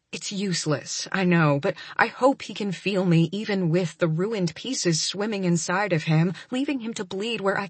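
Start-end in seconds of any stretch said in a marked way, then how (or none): none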